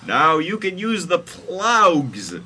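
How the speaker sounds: high, loud, slow proclamation; hammed-up comic prophet; Jesus character voice. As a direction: proclaim it slowly, high and big. Jesus' quoted speech in story.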